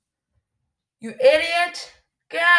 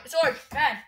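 ordinary delivery

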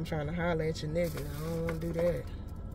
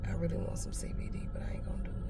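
whisper; 9 dB quieter, much faster than usual. Real, not acted. Confiding a craving to viewers.

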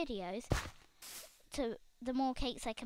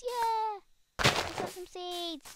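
excited; 7 semitones higher, 5 dB louder, slower than usual. Real, not acted. Finding seeds in game.

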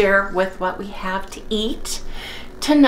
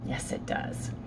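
soft breathy whisper to self; whispered aside; 12 dB quieter, slower than usual. Real, not acted. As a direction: murmur it to yourself, savouring. Savouring coffee smell.

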